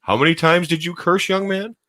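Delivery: a hint of a stern mom asking him to own up; scolding mom. Voicing Rachel scolding him.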